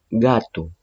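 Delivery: "gato" con G oclusiva, no jota; G sin sonido jota. Shown as a demonstration. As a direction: di "gato" con una G dura inicial. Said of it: "¿Suena como J? No". ("gato" pronunciado con G, nunca como jota)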